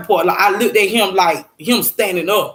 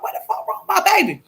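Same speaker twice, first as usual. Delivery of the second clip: high wailing re-enactment; retold crying; 11 semitones higher, faster than usual. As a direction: wail it high as if in tears. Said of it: "Like, literally crying".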